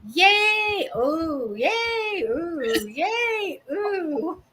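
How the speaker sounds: high, giddy yays dropping into ohs; roller coaster cheers. Pretend. Mimicking a roller coaster ride.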